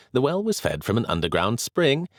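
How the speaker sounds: plain reading voice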